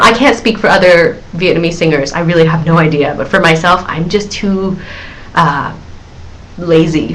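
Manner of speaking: ordinary talk, nothing marked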